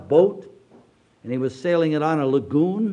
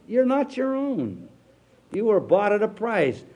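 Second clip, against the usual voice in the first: emphatic preaching; 6 semitones higher than usual. Acted. Preaching, higher and slower.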